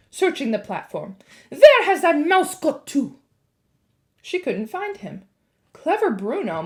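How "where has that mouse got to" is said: very high, loud snappish witch; witch snapping. A narrator's reading. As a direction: snap it, searching. Witch searches angrily.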